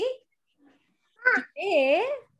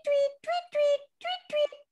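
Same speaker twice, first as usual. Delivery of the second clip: much higher chirping tweets; bird tweet; 13 semitones higher than usual. Acted. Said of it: "little birdie who goes tweet".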